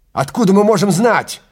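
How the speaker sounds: high, loud desperate cry; shout. Acted. Travis shouting at Lesperance, loud and high. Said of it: "крикнул Трэвис".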